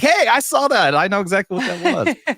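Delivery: high, loud excited mock callout, laughing at the end; playing the excited fan who spotted it. Speaker retelling how he called out to a guy with a replica, excited. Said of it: "I'm like".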